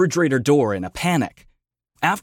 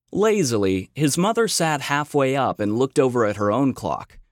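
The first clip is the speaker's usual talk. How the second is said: ordinary delivery, nothing marked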